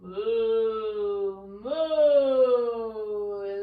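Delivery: long, low, loud mooing like a cow. Shown as a demonstration. Yoga teacher showing kids a cow moo. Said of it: "And then moo".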